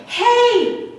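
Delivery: put-on high, thrilled children's cries; excited yelling. Children hearing Santa's sleigh bells, high excited yelling. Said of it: "they are screaming, and they are yelling".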